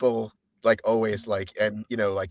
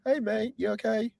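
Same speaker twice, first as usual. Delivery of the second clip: high, light Ian impression; chirpy high mate greeting; higher-pitched English mate; 12 semitones higher, 4 dB quieter than usual. American host mimicking a high English voice. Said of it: "much higher pitched".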